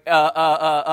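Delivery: usual speaking voice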